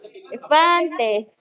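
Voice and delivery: "Elefante" with a long, slow "fan"; eleFAAANte, accented syllable drawn out; stress on FAN. Shown as a demonstration. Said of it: "dónde está el acento".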